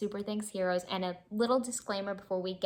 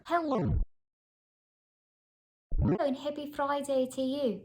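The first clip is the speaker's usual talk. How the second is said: higher put-on Irish or Scottish accent; 6 semitones higher than usual. Vlogger jokingly greeting viewers in a mock Irish or Scottish accent. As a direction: greet them in a playful Irish brogue. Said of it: "That's not my actual accent".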